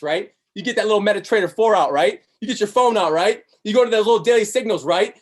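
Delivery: ordinary talk, nothing marked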